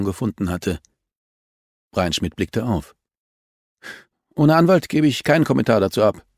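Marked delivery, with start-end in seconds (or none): 4.3-6.3 defiant refusal. A narrator's reading. Suspect refuses, raised voice.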